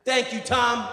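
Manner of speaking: loud, high model shout; yell. Shown as a demonstration. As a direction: call it out for someone to copy. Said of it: "try it now".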